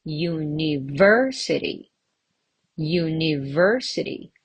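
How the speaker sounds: University with VER stressed; teacher's model of uniVERsity; stress on third syllable. Shown as a demonstration. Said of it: "On the third syllable".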